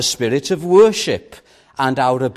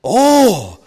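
Awe! called out high and loud; exclaimed; 8 semitones higher, 8 dB louder than usual. Real, not acted. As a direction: burst out with "Awe!" as a cry of wonder. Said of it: "preacher exclaims the word".

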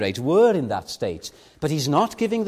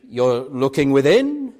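ordinary talk

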